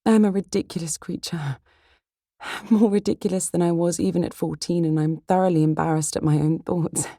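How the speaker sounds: self-reproach in a lifted voice; embarrassed heroine. Acted. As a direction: voice a heroine owning up to her own silliness.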